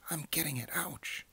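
high, pained little rat squeak; pained pack rat. Acted. Pack rat pricked by cactus, high pained voice.

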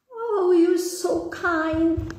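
high, slow, grateful cry; grateful sparrows. Acted. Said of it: "cried the sparrows".

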